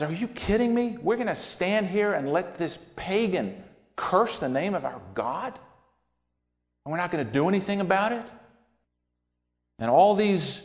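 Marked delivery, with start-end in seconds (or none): 0.0-8.3 high, fast indignant outburst; outraged disbelief, rushing the words; David indignant at the frightened army, high and fast. Retold or imitated. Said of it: "David becomes indignant".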